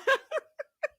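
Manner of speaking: high squealing laugh; cackle. Real, not acted. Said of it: "the way that I cackle".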